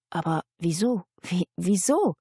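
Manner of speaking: halting, bewildered questions; helpless stammer. A narrator's reading. Johanna cannot understand it, helpless stammering. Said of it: "stotterte Johanna hilflos".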